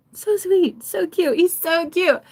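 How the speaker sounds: high, cutesy cooing; gushing. Real, not acted. Gushing over a lovable Aries, high and cooing. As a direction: coo over someone adorable.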